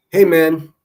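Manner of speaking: loud sharp warning to the troll; shouting across the chat to stop someone; calling out. Real, not acted. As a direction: bark it out to stop him.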